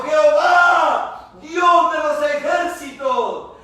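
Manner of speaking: loud, slow, high invocation; crying out to God. Acted. Preacher demonstrating invoking God by name, slow loud cry. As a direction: call on God at full voice, each phrase drawn out. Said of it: "Invocar es llamar por nombre propio".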